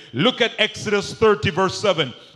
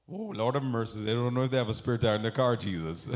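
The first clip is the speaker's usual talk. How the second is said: mock exasperation; 11 semitones lower, 11 dB quieter, faster than usual. Preacher feigns exasperation, mutters.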